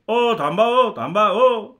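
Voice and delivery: high, loud hailing shout; urgent loud call to halt; calling after someone. Acted. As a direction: shout after a man walking off. Crowd calls him to stop.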